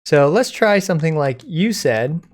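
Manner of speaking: normal talking voice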